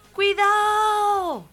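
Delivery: long, high, loud drawn-out yell; grito del camionero. Acted. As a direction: bellow it slowly as a warning. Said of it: "El camionero que grita". (grito largo y agudo)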